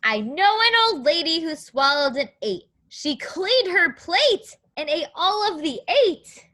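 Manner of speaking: put-on sing-song read-aloud rhyme for kids. Teacher reading a counting book to young children, sing-song.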